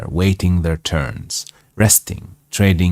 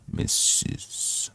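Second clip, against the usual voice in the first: whispered; 13 dB quieter than usual. Acted.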